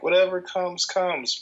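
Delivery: lilting mock free spirit; mock whimsical. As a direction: imitate someone blithely carefree. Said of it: "When I say whimsical, I don't mean".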